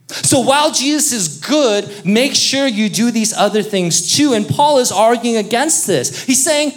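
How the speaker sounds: preacher pressing hard on works-based faith, fervent; preaching. Acted. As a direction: drive the point home to the congregation. Preaching at full force.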